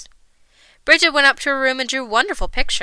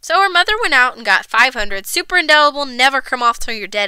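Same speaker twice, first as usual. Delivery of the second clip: ordinary delivery, nothing marked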